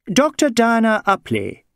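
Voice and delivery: high, crisp English doctor; brisk Englishwoman. Acted. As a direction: state your name crisply. English doctor gives her name.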